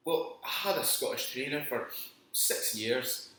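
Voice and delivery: playful fake Scottish brogue; put-on Scottish accent. Co-host explaining his accent, put-on Scottish accent. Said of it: "where did you pick up the accent?".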